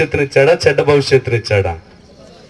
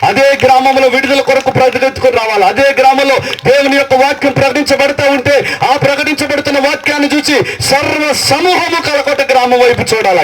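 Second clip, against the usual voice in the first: full-force preaching; 9 semitones higher than usual. Acted. Pastor recounting the feared village, full-force preaching. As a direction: drive the story of the village home.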